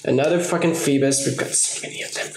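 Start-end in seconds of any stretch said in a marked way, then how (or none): 1.3-2.3 whispered aside. Real, not acted. Aside about duplicate cards.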